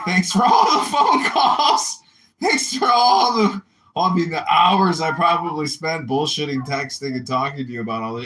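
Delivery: high, loud mocking thanks with laughter; sarcastic shout. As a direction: yell your fake gratitude, laughing. Sarcastic rant at the liar.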